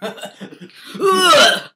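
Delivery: high squealing ugh; laughing shriek. Pretend.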